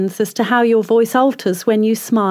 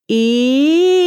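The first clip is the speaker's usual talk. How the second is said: e held on a rising glide; siren on e; 3 semitones higher, 3 dB louder than usual. Shown as a demonstration. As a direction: sustain the e and slide it up. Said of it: "Then the e".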